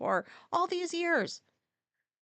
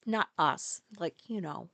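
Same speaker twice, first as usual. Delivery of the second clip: ordinary talk, nothing marked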